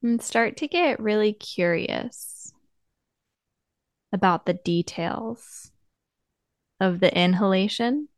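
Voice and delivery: soft, unhurried meditation voice with long pauses; guided meditation. Acted. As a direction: gently guide listeners inward, leaving space between phrases.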